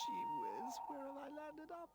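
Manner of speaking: high, tearful little-toy cry; sobbing. A narrator's reading. Lost toy Pino alone in the dark, high tearful sob. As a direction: sob out the question, lost and scared. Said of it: "he sobbed".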